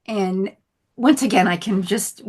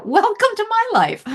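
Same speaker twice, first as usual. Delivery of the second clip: high mock cheer; 14 semitones higher, 5 dB louder than usual. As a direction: welcome jumps way up high and loud. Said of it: "repeats her punchline".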